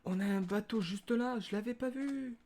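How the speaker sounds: high, rising voice of discovery; delighted surprise. Real, not acted. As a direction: pitch climbing in happy surprise. Surprised to spot a boat.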